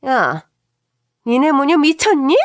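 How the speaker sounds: loud, high yelling at her daughter-in-law; furious shout. Retold or imitated. Mother-in-law shouting at daughter-in-law over the phone, furious yell. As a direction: bellow it at her in rage. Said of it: "우렁찬 목소리로 고함을 치시는 겁니다".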